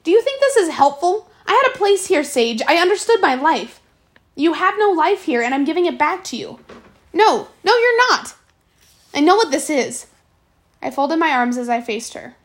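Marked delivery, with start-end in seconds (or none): 0.0-6.6 put-on raised, higher and louder girl's fury; an angry girl pushing back at her friend; even angrier. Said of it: "that made her even angrier". 7.1-10.1 heated denial. Acted. Sage snaps back in quarrel.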